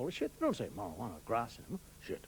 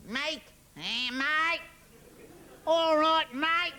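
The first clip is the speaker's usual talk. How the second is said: high-pitched Australian challenge; belligerent Australian; 6 semitones higher, slower than usual. Acted. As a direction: dare him in a put-on Aussie accent. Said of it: "The Australians".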